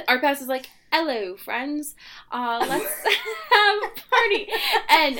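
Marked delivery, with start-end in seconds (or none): 0.9-4.5 higher-pitched fake Cockney, breaking into laughter; badly faked Cockney accent with a giggle; mock Cockney accent. Podcast host imitating a film star greeting party guests, mock Cockney. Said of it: "in this accent".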